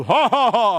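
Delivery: loud ho ho ho; giant laugh. Shown as a demonstration. Teller demonstrating a giant's laugh, booming. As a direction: boom it out like a giant. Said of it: "ketawa raksasa begitu".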